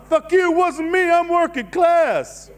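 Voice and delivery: mock defiant retort; defiant working-class father. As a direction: snap back like a proud dad. Father's defiant reply voiced.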